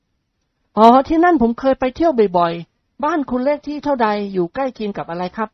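eager suitor voice. Acted. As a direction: coax her eagerly. Nikorn asks eagerly. (เสียงชายหนุ่มกระตือรือร้นเกลี้ยกล่อม)

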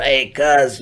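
a hint of a scratchy street voice; lightly voiced scratchy homie; Big C Style's voice. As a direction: lightly imitate his scratchy greeting. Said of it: "that's how he talked. His voice was kinda scratchy".

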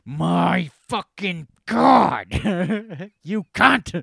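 slow, loud, hammed-up outrage; mad mom. Imagined mother finally Googling Grimace, bellowing in mock fury. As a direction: stretch every word into a huge mock bellow. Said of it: "She was so fucking mad".

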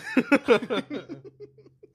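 high, loud burst of laughter; real laughter. Real, not acted. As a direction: crack up helplessly, high and loud. Helpless giggling over farting.

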